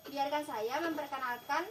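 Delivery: ordinary talk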